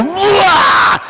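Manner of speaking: big held cartoon retch; retching yell. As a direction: imitate a man suddenly throwing up. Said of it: "This guy goes".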